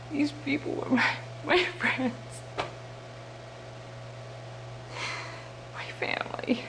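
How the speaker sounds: halting grief fading to a whisper; grieving, breaking down. Acted. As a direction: let the voice fail with long pauses. Grieving for killed comrades.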